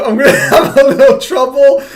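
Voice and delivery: high, loud, amused talk broken by laughter; laughing. Real, not acted. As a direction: crack up while admitting trouble. Laughing at a hard foreign word.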